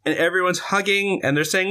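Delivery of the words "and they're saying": usual speaking voice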